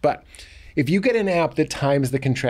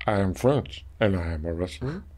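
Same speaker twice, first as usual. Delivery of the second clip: put-on low, soft French-accented giant voice; Andre the Giant impression; 4 semitones lower, 6 dB quieter than usual. Mimicking Andre the Giant introducing himself, low French accent. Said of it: "my only Andre the Giant impression".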